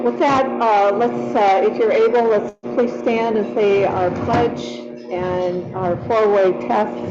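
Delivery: normal talking voice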